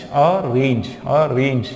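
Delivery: अरेंज as two parts, अर-रेंज; split into syllables. Shown as a demonstration. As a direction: say अरेंज split after a held first syllable, each time. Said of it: "संधि विच्छेद करके".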